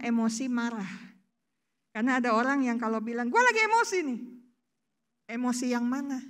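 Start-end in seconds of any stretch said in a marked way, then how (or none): 3.3-4.2 mock complaint. Quoting someone who says emotional.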